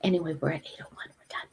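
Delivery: quick whisper under breath; whispered aside. Real, not acted. Host wrapping up the stream, whispered. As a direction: hurry through it in a whisper.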